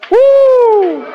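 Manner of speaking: very high laughing whoop; laughing woo. Real, not acted. Preacher whooping with joy mid-hymn, very high laughing cry. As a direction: burst out with a joyful whoop.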